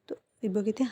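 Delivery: ordinary delivery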